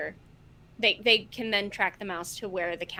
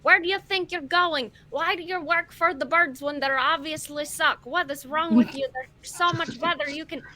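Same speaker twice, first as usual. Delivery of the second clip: higher, louder, scolding rant; indignant yelling after someone; 5 semitones higher, 5 dB louder than usual. Acted. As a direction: call out in outrage at someone getting away. Player yells after fleeing mouse.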